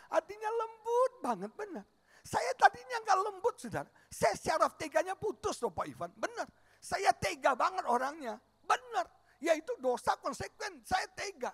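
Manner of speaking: loud revival preaching; preaching at full force. Acted. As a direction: drive it home to the congregation. Pastor preaches emphatically.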